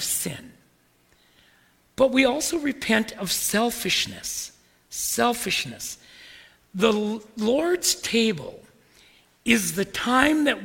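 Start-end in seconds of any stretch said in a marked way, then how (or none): none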